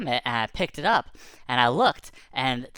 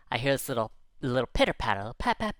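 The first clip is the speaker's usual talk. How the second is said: ordinary delivery, nothing marked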